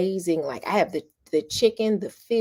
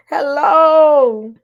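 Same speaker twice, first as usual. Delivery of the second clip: loud hello; 8 semitones higher, 12 dB louder than usual. Real, not acted. Calling out, laughing.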